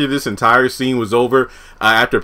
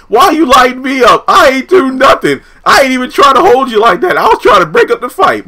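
high loud protest; 11 semitones higher, 5 dB louder than usual. Retold or imitated. John protesting.

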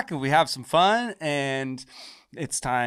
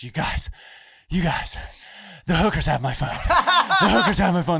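acting out panic, building higher and louder; retold scared panic; 6 semitones higher, 6 dB louder than usual. Friend barging into the bar to his buddies, panicking. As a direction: burst in with a mock-frantic plea that climbs. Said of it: "Sweat, so scared".

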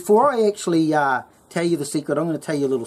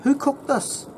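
high, curious mock uncle; much higher uncle asking who cooked; 10 semitones higher than usual. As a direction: lightly voice the uncle's surprised question. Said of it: "and then he says to me".

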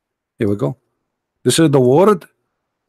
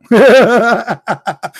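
high scornful burst of laughter; a short derisive laugh; mocking laugh; 7 semitones higher than usual. Real, not acted. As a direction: laugh him off. Laughing at opponent's claim.

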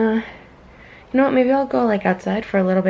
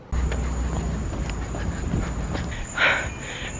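long breathy sigh; 7 dB quieter than usual. Real, not acted. Looking at the view outside.

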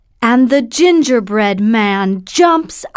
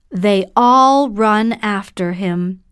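usual speaking voice

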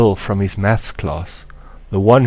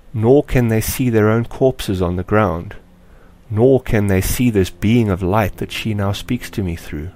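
ordinary delivery

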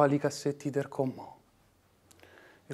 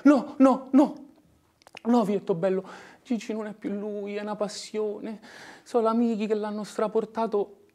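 pleading mother; 7 semitones higher, 6 dB louder than usual. Acted. Mother pleads for her son.

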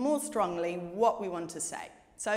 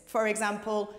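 ordinary delivery

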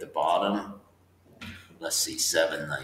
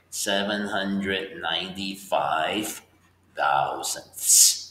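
slow, careful reading of the number; teacher reading a decimal aloud slowly; 3 semitones higher, much slower than usual. Shown as a demonstration. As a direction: read "Seven hundred ninety-five" slowly so students can follow. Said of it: "maybe I'll slow it down".

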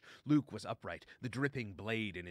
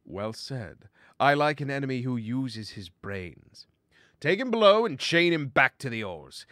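amused praise building to a loud order; pirate captain calling out to the crew; commanding pirate stoat; 4 semitones higher, 13 dB louder than usual. Acted. Daskar praises his enemy, then orders him chained, loud and commanding.

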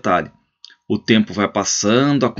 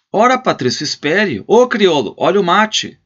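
much higher and louder call; 9 semitones higher, 4 dB louder than usual. Acted. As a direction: hold the guest, yell for the mate. Holding guest, calling servant.

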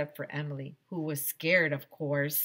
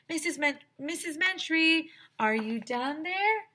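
hollering; 12 semitones higher, 4 dB louder than usual. A narrator's reading. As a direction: call down into the dark. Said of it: "Michael hollered".

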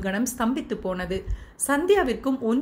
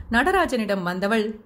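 ordinary delivery

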